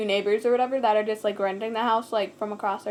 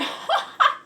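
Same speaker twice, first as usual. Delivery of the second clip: burst of laughter. Real, not acted. Laughing at a movie title.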